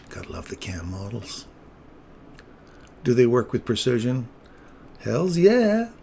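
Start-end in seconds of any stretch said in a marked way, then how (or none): 0.1-1.5 soft unvoiced whisper; whispered aside. Real, not acted. Streamer whispering an aside to the viewers. 5.0-5.9 high, loud whoop of delight; excited cheer. Real, not acted. Streamer cheering his precision trade to chat.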